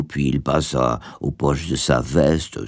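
ordinary delivery

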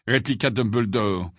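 ordinary delivery, nothing marked

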